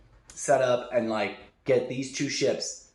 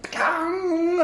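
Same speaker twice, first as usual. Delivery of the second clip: high, sharp vocal sound effect; comic imitation of a collective reaction; imitated "pkang" noise; 14 semitones higher than usual. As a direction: burst out a high ringing pkang.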